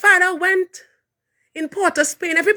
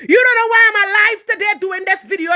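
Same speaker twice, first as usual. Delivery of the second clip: ordinary delivery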